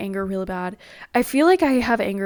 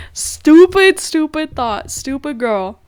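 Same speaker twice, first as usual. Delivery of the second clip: sharp, much louder and higher burst at herself; self-scolding; 6 semitones higher, 9 dB louder, much slower than usual. Real, not acted. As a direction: snap at your own silly worry. Scolding herself after anxious thought.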